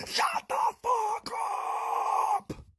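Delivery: very high, drawn-out imitated scream; full-lung scream. Imitating the man screaming at a crying kid, shrieking. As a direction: scream it out long, at full strain. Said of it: "screams at the top of his lungs".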